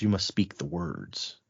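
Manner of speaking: low, soft, slow retold command; solemn instruction. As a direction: intone it slowly and low, as a solemn charge.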